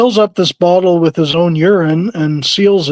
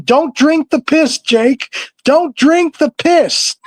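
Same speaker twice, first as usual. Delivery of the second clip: mock yelling; 9 semitones higher, slower than usual. Storyteller imitating a wrestler begging his rival to stop, high mock yell. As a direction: imitate a panicked yell, pitched way up. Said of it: "Honky Tonk is busy yelling".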